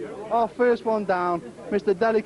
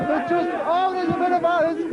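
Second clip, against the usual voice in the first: ordinary delivery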